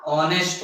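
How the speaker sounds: "ऑनेस्ट" low and soft, no H; silent H. Shown as a demonstration. Teacher drilling the class on honest, "ऑनेस्ट" with silent H. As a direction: drop low and begin "ऑनेस्ट" on the vowel. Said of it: "क्या होगा?".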